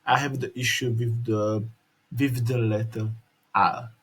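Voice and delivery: ordinary talk, nothing marked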